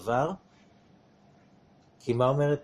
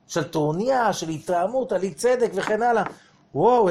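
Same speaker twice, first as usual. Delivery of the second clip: higher, louder, worked-up inner voice; excited self-realization; 8 semitones higher, 6 dB louder than usual. Acted. Rabbi acting a listener's inner discovery, excited and loud. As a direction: voice a man discovering his own anger, amazed.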